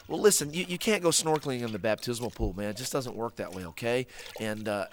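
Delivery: ordinary delivery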